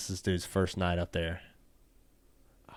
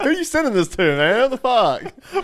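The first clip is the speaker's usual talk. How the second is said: high, loud disbelieving demand; high-pitched, loud "what is going on" outburst; mock outrage; 14 semitones higher, 9 dB louder than usual. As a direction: demand answers in exaggerated alarm. Acted confrontation over the saved photo.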